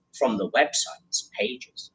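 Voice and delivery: secretive hushed whisper. Acted. Child sneaks past cages to the door, whispering.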